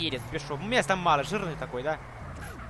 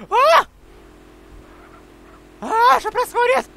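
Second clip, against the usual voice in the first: high, startled yelp; startled shout; 12 semitones higher, 7 dB louder than usual. Real, not acted. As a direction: jump in surprise and ask. Startled by a glitch.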